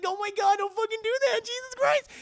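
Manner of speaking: very high, loud imitation; hammed-up shrieking heroine; mock panicked plea. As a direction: beg in exaggerated alarm. Retold panicked plea to boyfriend.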